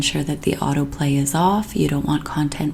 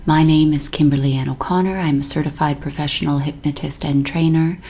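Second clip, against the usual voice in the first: usual speaking voice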